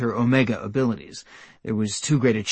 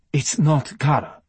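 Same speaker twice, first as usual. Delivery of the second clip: definitive; 3 semitones higher than usual. A narrator's reading. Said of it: "said Asil definitively".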